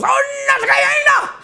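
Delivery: very high-pitched mock cry in bed; hammed-up high wail; mock Japanese woman. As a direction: squeal it out as a caricatured Japanese woman. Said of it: "and she goes".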